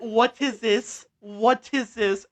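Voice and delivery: very loud, high and drawn-out demand; mock airport officer. Airport security questioning a traveller's melatonin, loud hammed-up interrogation. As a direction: hammer it out slowly as an indignant official. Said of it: "and they were like".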